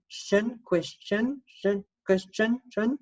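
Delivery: "shin" and "question" sung out high, rising each time; sound shin. Shown as a demonstration. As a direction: bounce between "shin" and "question". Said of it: "Notice it's got a T I O N".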